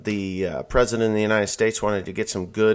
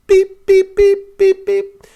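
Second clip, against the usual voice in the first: high, loud beeps like a cash register; imitated register beeps; 9 dB louder, slower than usual. Mimicking the register scanning steaks, high loud beeps. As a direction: beep out each scanned item.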